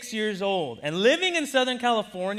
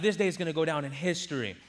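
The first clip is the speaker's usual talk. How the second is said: ordinary delivery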